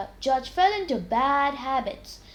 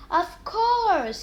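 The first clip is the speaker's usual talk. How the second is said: higher and louder sudden realisation; exclaiming; 8 semitones higher, 5 dB louder than usual. A narrator's reading. Jerry realising he forgot Pinto, exclaiming. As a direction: burst out with the answer. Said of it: "he exclaimed".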